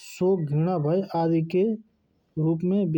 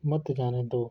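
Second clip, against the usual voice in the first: "मत जाने दो" run together as one lower phrase; phrase after the pause; 5 semitones lower, 4 dB quieter than usual. Shown as a demonstration. Teacher finishing a punctuation example, "मत जाने दो" as one falling phrase. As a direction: keep "मत जाने दो" unbroken, falling to a close. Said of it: "विराम लग गया".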